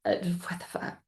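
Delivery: whispered disbelief under the breath. Retold or imitated. Stranded at the gate without a boarding pass, whispered disbelief. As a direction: hiss it in stunned panic. Missed flight, boyfriend gone.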